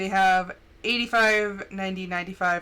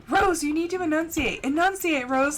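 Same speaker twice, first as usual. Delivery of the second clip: higher, insistent mock mom voice; nagging mom; 6 semitones higher than usual. Mom correcting her daughter's speech, mock nagging. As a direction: repeat mom's correction, exasperated. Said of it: "when my mom would say".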